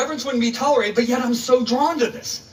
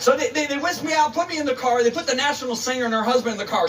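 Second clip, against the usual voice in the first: usual speaking voice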